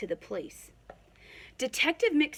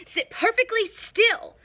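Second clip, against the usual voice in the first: much higher and louder, harsh bark; stern command; 13 semitones higher, 8 dB louder than usual. A narrator's reading. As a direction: bark it at the class. Miss Swamp commands the class.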